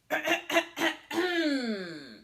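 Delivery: loud showy throat clearing; wolf clears throat. Acted. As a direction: clear your throat before the big line. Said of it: "He cleared his throat".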